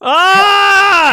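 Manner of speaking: loud, high whoop of delight; excited shout. Real, not acted. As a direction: scream with glee. Cheering a gross reveal.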